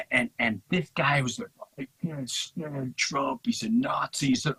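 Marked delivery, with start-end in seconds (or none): 1.8-4.3 high-pitched imitation of an indignant hater; mimicking someone's overwrought complaint; mock political outrage. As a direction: whine it in a much higher put-on voice. Mimics angry Trump hater.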